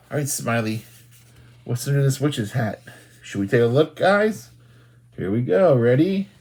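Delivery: plain reading voice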